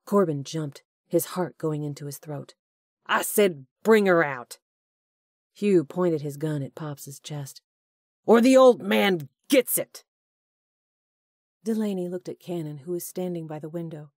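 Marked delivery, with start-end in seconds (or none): 3.0-4.6 loud, threatening command; furious shout. Acted. Hugh threatens with gun. 8.2-10.0 high, loud raging threat; furious threat shout. Acted. Hugh threatens Pops.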